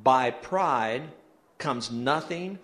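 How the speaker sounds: slow, measured group reading; read aloud together. Real, not acted. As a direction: lead the congregation word by word, slowly. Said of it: "read it out loud with me".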